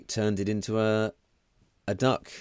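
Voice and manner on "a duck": normal talking voice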